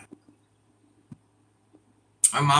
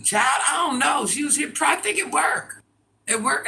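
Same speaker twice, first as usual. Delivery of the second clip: animated, much higher-pitched answer; high-pitched dismissal; 10 semitones higher than usual. Real, not acted. Streamer answering about Dallas, high-pitched and animated. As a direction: toss it off high and lively.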